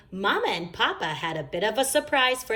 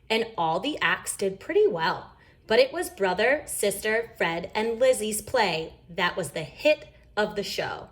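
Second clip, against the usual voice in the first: usual speaking voice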